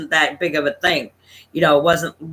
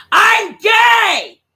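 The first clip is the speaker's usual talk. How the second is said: much higher, loud shouted confession; shouting over the music; 6 dB louder than usual. Retold or imitated. Son shouts to his brother over the loud bar music, full shout. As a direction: yell it over the bar noise.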